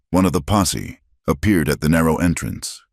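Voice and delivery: ordinary delivery, nothing marked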